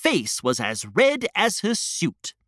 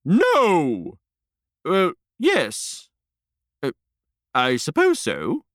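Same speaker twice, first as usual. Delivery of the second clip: reluctant queen. Acted.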